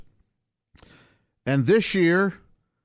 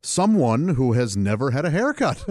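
ordinary talk, nothing marked

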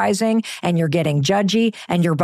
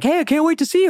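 mock cheerful friend; 8 semitones higher, faster than usual. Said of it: "texts from her friend Phil".

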